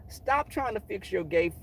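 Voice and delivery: normal talking voice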